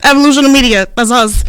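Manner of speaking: babbled, then blurted event plugs; mock your own tongue-tied mic announcement; imitating nervous hosting. Shy host recalling thirty seconds on mic, mock-flustered blurting. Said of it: "and I was like".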